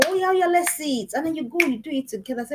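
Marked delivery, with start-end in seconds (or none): 0.1-1.1 higher-pitched, encouraging response; pitched-up show of interest; eager parent. Acted. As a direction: act keen to see the child's work. Parent eagerly answers child showing work.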